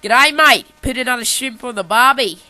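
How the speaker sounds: loud, hammed-up Aussie accent; big, brash mock Australian. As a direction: belt out a cartoon Australian greeting. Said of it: "then Australia's like".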